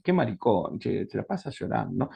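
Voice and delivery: low, soft scornful aside under the breath; a quiet, contemptuous private thought about someone who always cries; muttered inner thought. Retold or imitated. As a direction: mutter it to yourself, dismissively. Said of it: "Que de alguna manera decís".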